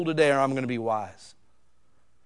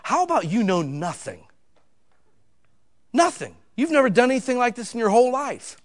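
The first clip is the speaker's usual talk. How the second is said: put-on higher, scolding a know-it-all child; higher exasperated scolding; exasperated parent; 7 semitones higher than usual. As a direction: lecture the know-it-all daughter. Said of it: "But I said".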